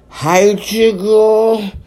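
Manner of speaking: little Roo shouting hi across the snow; shouted greeting. Acted. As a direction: call out happily to a friend. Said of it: "shouted Roo".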